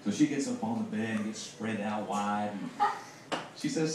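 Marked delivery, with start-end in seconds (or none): none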